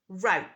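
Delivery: route rhyming with out, rowt; American route. Shown as a demonstration. Teacher models American route. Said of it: "in American English, we say".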